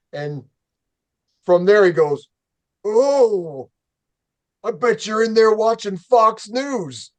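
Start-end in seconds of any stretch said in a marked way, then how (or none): 2.8-7.1 higher sneering voice for the neighbor; mocking neighbor. Neighbor taunting the narrator over the fence, higher mock sneer. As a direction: taunt like the smug guy next door.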